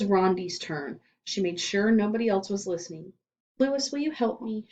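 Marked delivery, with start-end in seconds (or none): none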